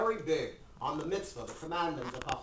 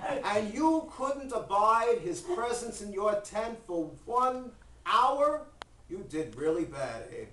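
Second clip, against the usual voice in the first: slow, drawn-out, raised voice of God; rebuking God; 3 semitones higher, 4 dB louder, slower than usual. Acted. God scolding Abraham over the guest, slow and emphatic. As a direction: stretch every word into a weighty rebuke.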